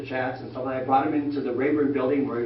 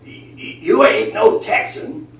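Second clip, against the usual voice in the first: put-on slow, high and louder old Texan drawl; 4 semitones higher, 7 dB louder than usual. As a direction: lightly play an old Texan rancher. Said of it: "the old man spoke up".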